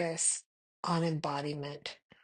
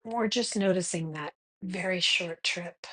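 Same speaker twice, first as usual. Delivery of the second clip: guided meditation. Acted. Meditation guide leading listeners to notice their breath, calm guided meditation. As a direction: guide them gently into the breath, unhurried.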